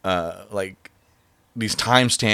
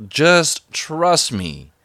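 slow, higher, drawn-out "just trust me"; confident reassurance; 8 semitones higher, 6 dB louder, much slower than usual. Retold or imitated. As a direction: stretch it out, coolly sure of yourself. Quoting himself reassuring his ex.

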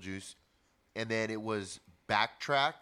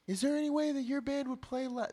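much higher imitation of the singer asking politely; singer's high voice. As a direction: put on his high, thin voice for the request. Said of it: "That's how he talks".

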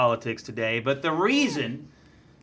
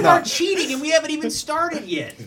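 mock outrage; 12 semitones higher than usual. Real, not acted. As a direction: scold them in fake outrage. Scolds whispering panelists, laughing.

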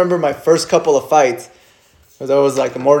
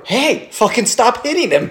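high, lightly acted command; a hint of a referee losing patience; pitched-up mock order to stop; mock angry referee; 9 semitones higher than usual. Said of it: "he would just be like".

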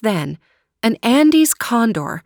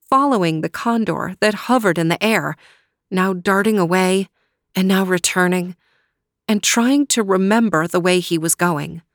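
usual speaking voice

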